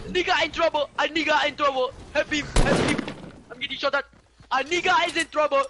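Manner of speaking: shouting. Real, not acted. Calling teammates for help in game.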